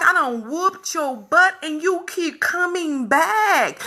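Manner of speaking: ordinary delivery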